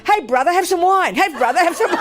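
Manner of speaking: high, lightly voiced chummy sister; sister offering wine. Sister offering the gunman wine, comically friendly.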